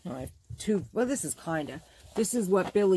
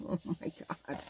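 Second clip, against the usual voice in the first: breathy, hushed swoon; awestruck whisper; 9 dB quieter than usual. Real, not acted. Crafter admiring solid red vinyl, awestruck whisper. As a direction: whisper it as if overcome.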